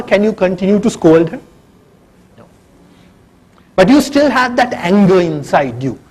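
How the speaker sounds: ordinary talk, nothing marked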